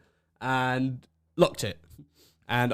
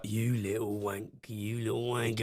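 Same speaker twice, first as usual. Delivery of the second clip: quiet, slow seething insult; retold simmering rage, held back; 3 semitones lower, 9 dB quieter, slower than usual. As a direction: draw it out under your breath. Retold fury at mirror kicker.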